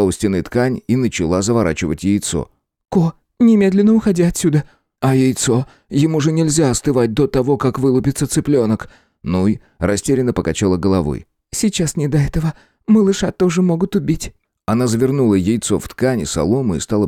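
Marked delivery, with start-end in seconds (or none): 2.9-14.3 higher, urgent woman's voice; urgent young woman. A narrator's reading. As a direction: urge him to run. Nuy urges boy to flee.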